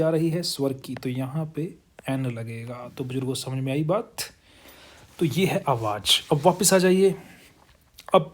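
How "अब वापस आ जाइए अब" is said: higher, louder call to return; calling back. Real, not acted. Teacher calling students back to the board, louder.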